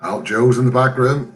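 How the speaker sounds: ordinary delivery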